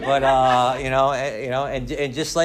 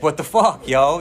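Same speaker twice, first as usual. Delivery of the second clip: louder exasperated cry; frustrated outburst; 2 semitones higher, 7 dB louder, slower than usual. Retold or imitated. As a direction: vent the frustration. Frustrated outburst, broke and ashamed.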